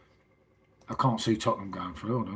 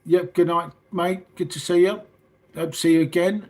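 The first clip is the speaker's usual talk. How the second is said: higher, louder chirpy goodbye to a shopkeeper; cheery shop farewell; 6 semitones higher, 5 dB louder than usual. Retold or imitated. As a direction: mimic a bright, over-friendly see-you-later. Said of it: "you're like".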